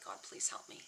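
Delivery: soft, low, almost whispered prayer; quiet plea. Retold or imitated. Praying to God for help, hushed and low. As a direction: plead under your breath, low and hushed.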